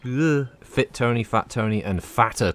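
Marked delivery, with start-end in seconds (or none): none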